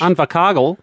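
southern New Zealand accent; broad kiwi accent. Shown as a demonstration. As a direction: say it like a South Island local. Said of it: "I'll give you New Zealand".

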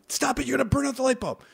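high, fast, exasperated nagging; mock scolding parent. Parent telling kid to leave the switch, high scolding. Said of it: "and you're like".

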